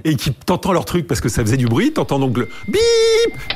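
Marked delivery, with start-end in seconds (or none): 2.7-3.5 long high drawn-out beep; imitated modem beep. Founder miming the server room noise, long high beep. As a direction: mimic the Minitel beep, stretched slowly. Imitating Minitel connection sound.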